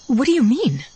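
put-on high, frightened girlish voice; scared little girl. A narrator's reading. Scared sister questions her changed brother, high girlish fear. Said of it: "She sounds like a scared little girl".

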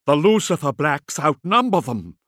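loud, high, panicky urging; near hysteria. A narrator's reading. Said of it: "Sark sounded no better".

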